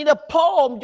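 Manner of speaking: loud, high, drawn-out "pom"; booming sound effect. Retold or imitated. Telling listeners how it flipped, booming sound effect. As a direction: imitate a big bang, stretched out. Imitates the boom of the strike.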